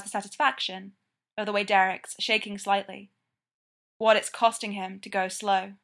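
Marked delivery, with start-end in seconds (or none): none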